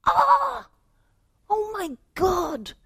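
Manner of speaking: high shriek then slow, high panic; panicked cry. Acted. Salesman panicking at the sign, high panicked cry. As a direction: cry out in dismay.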